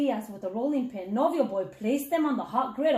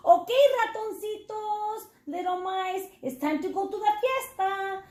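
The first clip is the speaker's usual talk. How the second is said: higher, lightly voiced friendly dog calling the mice; 6 semitones higher than usual. A narrator's reading. Chorizo the dog gathering the mice for the party, higher and friendly. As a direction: call the little mice along, pitched up and kind.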